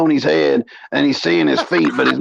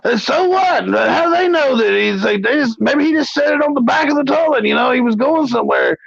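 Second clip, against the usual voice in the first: much higher, whiny puzzled voice; imitating Rebel's question; 7 semitones higher than usual. Rebel asking Mo about the pizza rumour, mocked in a high whine. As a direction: pitch it up like a baffled complainer. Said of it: "He goes".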